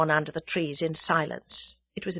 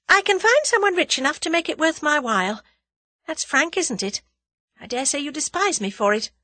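put-on higher, bold girlish voice; frank young woman; 7 semitones higher than usual. A narrator's reading. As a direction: state it plainly, chin up. Tuppence voiced bravely frank.

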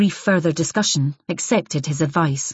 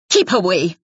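curt warning; 4 dB louder than usual. Acted. Wife's final warning.

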